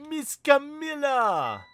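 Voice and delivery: put-on high, slow childlike call, stretched out; a small girl calling her friend's name; child calling out. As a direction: call to her like a little kid.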